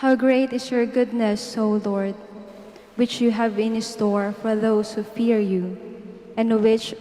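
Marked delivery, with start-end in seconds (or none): none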